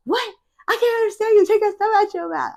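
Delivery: high, fast mocking brother voice; imitating brother. Brother complaining about thumb-sucking speech, high mocking imitation. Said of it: "he be like".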